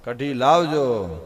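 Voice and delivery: slow, drawn-out "કઢી લાવજો" at normal pitch; guest's fourth slow request for kadhi; slow fourth ask. Retold or imitated. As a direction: stretch the words out, all the fight gone. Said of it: "પણ ચોથી વાર કીધું".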